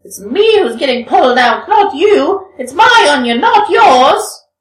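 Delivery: wicked woman's shriek. Acted. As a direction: shriek at the sinners to let go. Wicked woman kicks off sinners.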